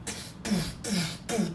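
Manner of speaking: soft, voiceless puffed gunshot noises; softly mouthed blast effects; imitated gunshots. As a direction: puff out shotgun blasts with the lips.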